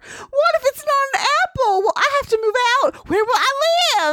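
very high anxious filly; panicky filly voice. Acted. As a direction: spiral into high worried questions. Voicing anxious Apple Bloom.

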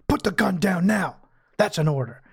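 lightly voiced stern dad giving an order; a hint of firm fatherly command. Recapping Mac ordering the gun down, dad voice. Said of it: "puts on his dad voice and says".

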